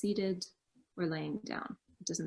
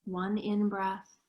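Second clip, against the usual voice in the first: guided meditation. Acted. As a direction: guide them gently into stillness. Leads a meditation.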